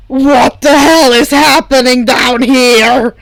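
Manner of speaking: loud, slow, annoyed yell; half-asleep yell. A narrator's reading. Dabi storming in on the others, a loud, slow, annoyed yell. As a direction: bark it from the doorway, loud and slow.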